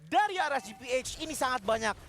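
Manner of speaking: energetic caster recap; upbeat esports commentary; fighting game announcer. Acted. Hyped recap after teamfight. (komentator yang bersemangat)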